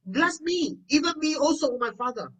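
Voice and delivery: high-pitched acted pleading; mild mock weeping, no sobs; tearful plea. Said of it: "lifted up his voice and wept".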